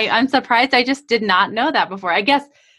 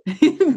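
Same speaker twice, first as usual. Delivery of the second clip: short laugh; 4 semitones higher than usual. Real, not acted. Host laughs at Raj's joke.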